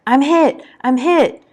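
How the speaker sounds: high, loud cry of a wounded soldier; urgent battlefield scream, narrator's version. A narrator's reading. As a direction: scream it out in alarm, pitched well up. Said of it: "Someone nearby is screaming".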